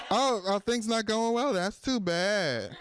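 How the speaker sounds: high mock sympathy, drawn-out ending; bitter ex-girlfriend. Jilted ex hearing of the bank's troubles, gloating. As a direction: gloat through fake sympathy, stretching the last words. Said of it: "like a bitter ex-girlfriend".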